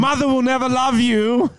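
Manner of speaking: high and loud, cruel proclamation; loud cruel declaration. Acted. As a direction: shout the insult up high. Quoting cruel knighting line.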